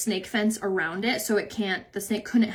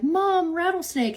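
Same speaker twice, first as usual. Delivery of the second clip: put-on higher, excited little boy voice; little son calling; 8 semitones higher, slower than usual. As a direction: imitate an eager kid pointing out a snake.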